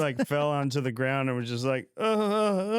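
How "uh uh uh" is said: high-pitched repeated groans, imitated; mock collapse groans. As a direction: groan like someone who just fell down overwhelmed. Imitates man collapsed on ground.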